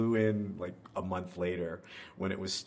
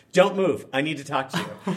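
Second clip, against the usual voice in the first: higher and louder, barked order; imitating a boss's loud urgent call; urgent command; 6 semitones higher, 9 dB louder than usual. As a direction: call out sharply from a car window. Head of conservatory calls from car.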